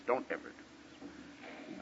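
slow, hushed whisper; grave, drawn-out whisper; whispered warning. Real, not acted.

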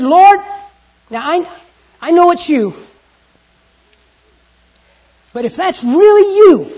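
loud, high pleading call; loud, high Peter pleading from the boat. Acted. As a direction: cry out to Jesus across the water. Preacher acting Peter calling out.

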